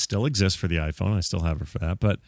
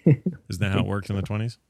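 ordinary talk, nothing marked

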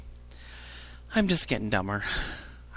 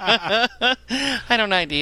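laughing; 7 semitones higher, 5 dB louder, much slower than usual. Real, not acted. Laughing at her own joke.

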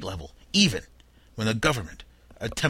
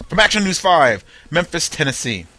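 loud, higher announcer voice; grand news intro; 5 semitones higher, 4 dB louder than usual. Shown as a demonstration. Host hamming up the station name over music. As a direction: proclaim it like a TV news opener. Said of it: "I feel very important when I say that".

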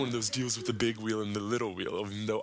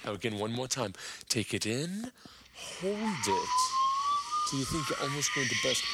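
mock guided breathing. Comic leading the crowd through a mock breathing exercise, calm instructor voice.

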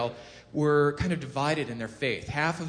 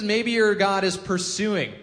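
higher, louder, drawn-out jeer; slow mocking taunt; 7 semitones higher, 7 dB louder, slower than usual. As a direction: savour the insult slowly and loudly. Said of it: "Elijah's just taunting them".

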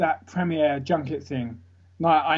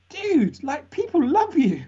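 put-on high, excited girlfriend voice; fiance voice; 11 semitones higher, 3 dB louder, slower than usual. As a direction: squeal it like his fiance. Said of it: "and she's like".

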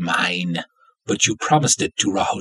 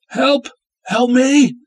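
high, loud desperate scream; screaming for help; 6 dB louder, slower than usual. Acted. Tied-up woman screaming for rescue, desperate. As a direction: scream for anyone to hear. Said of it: "Scream all you want".

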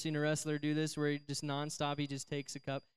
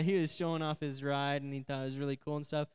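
ordinary talk, nothing marked

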